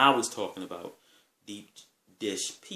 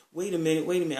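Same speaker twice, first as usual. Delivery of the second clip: urgent interruption; 7 semitones higher, 5 dB louder, much faster than usual. Retold or imitated. Friend tries to stop them leaving.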